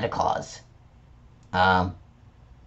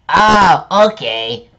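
ordinary talk, nothing marked

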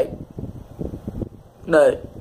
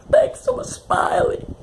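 crying wail; slower than usual. Real, not acted. Grieving the character, repeated.

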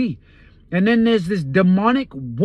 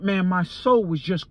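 normal talking voice

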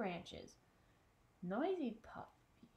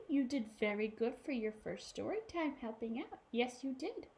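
ordinary delivery, nothing marked

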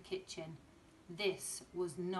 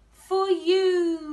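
put-on high loud proud little boy; beaming toddler voice; 11 semitones higher, 17 dB louder than usual. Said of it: "he beamed".

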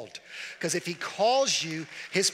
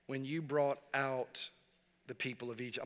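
slowed down, trailing softer, mulling it over; slow pondering reread; 3 semitones lower, 5 dB quieter than usual. Shown as a demonstration. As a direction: read it back slowly as if questioning God. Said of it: "I talk back to the Bible".

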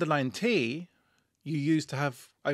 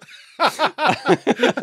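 loud, high burst of cackling; high-pitched cackle; 8 semitones higher, 7 dB louder than usual. Real, not acted. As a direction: let out a real high cackle. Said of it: "that high-pitched laugh".

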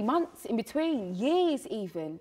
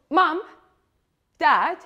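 loud, raised call to parents; 4 semitones higher, 9 dB louder than usual. Acted. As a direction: call out each name firmly, separately. Addressing parents in a monologue.

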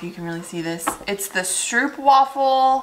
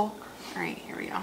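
hushed whisper before a bite; 2 semitones lower, 15 dB quieter than usual. Real, not acted. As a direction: whisper to yourself, bracing.

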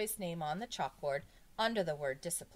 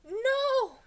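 very high, loud scream; shrieked schoolboy refusal; 13 dB louder than usual. A narrator's reading. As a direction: scream in sheer horror. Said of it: "Jason screamed".

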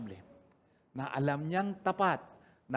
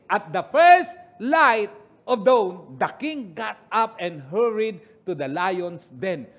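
loud, slow scripture read; 9 dB louder, slower than usual. Acted. Preacher reading the verse aloud to church. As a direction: proclaim the verse to the congregation.

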